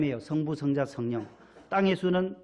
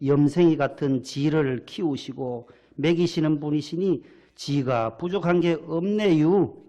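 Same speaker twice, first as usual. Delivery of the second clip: Chungcheong dialect; 4 dB louder than usual. Acted. Pastor now gives the congregation the Psalm in Chungcheong dialect. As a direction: read scripture like a Chungcheong local. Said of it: "충청도 분도 또, 또 좀 다른가 봐요".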